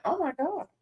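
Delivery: scandalised whisper-ish high voice; shocked aside. Retold or imitated. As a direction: breathe it out like you can't believe she said that. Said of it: "I'm like".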